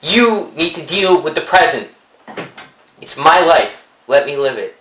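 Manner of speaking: loud, high, heated shouting; angry shouting. Acted. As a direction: shout back defensively. Heated mock quarrel.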